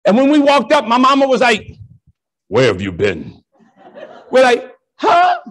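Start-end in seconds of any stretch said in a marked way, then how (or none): 2.5-3.4 low, stern mama voice. Retold or imitated. Mama on the porch demanding where the kids were, low and stern. 4.9-5.4 innocent huh. Retold or imitated. Kids playing dumb to mama.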